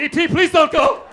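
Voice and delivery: high, loud tearful begging; hammed-up wailing plea; about to cry. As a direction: beg desperately through mock tears. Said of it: "I was about to cry".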